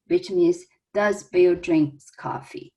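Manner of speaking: usual speaking voice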